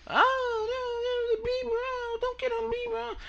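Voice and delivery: very high, wailing mock sob of a fan; whiny crying fan. Mocking [name] fans online, high fake crying. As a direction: wail like a heartbroken teen fan.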